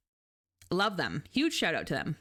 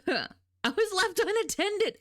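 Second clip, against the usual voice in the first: mock pitiful; 11 semitones higher, much slower than usual. Joking excuse.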